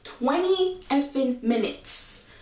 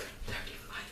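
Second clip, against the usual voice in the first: whisper; 17 dB quieter than usual. Real, not acted. Confiding aside to viewers.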